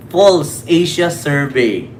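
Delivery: "False Asia Survey" said slowly, punching "False" with a falling tune; slow emphatic. Shown as a demonstration. Vlogger mocking the survey name to DDS viewers, slow and pointed. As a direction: spell out the title slowly, hit "False". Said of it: "sa title pa lang ng survey".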